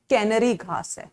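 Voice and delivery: कैनरी said as KAI-nuh-ree; correct pronunciation. Shown as a demonstration. Teacher correcting the class on कैनरी, careful pronunciation. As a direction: say कैनरी with the vowel of "canary" as kai. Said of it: "सब accent में बोलेंगे".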